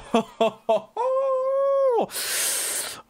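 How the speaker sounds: high, long whoop of delight; joyful whooping laugh. Real, not acted. Celebrating the pull.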